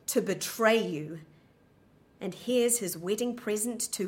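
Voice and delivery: loud, slow, bitter theatrical delivery; dramatic recitation. Acted. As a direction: declaim it slowly, full of scorn, like on stage. Dramatic monologue before the interview.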